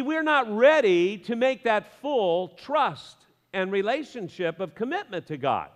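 ordinary talk

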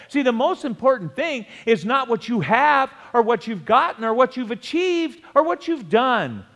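high, driving sermon delivery; urgent pulpit exhortation; impassioned preaching. Real, not acted. Preaches at full force.

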